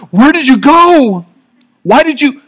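a parent near panic, half angry; mock panicked parent searching the grocery store. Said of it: "on the verge of angry and panic".

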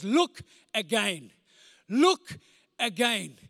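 slow, weighty, with LOOK lifted; emphatic slow. Acted. Preacher announces the message title, slow and weighty. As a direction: lay the words down slowly. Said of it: "It says".

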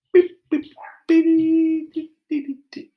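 high mouth-made beep beep; silly imitated car horn.

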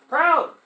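PROUD called out high and loud; answer for the class. Shown as a demonstration. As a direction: give the answer Proud with a big high fall. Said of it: "he's very what?".

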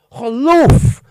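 Geloof loud and drawn out; stress on Geloof. Real, not acted. Preacher corrects "feel" and "think" to believe, stressing Geloof. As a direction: hit Geloof hard and stretch it.

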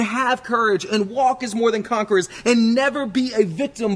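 rally the church with triumph; rousing preaching. Acted. Rousing sermon close.

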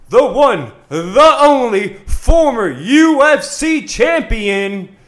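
big booming fight intro; boxing ring announcer. Acted. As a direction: stretch every word for the crowd.

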